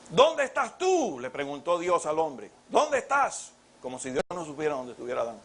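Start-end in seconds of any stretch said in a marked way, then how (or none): 0.1-1.2 calling voice of God. Retold or imitated. 2.7-3.5 calling voice of God. Retold or imitated.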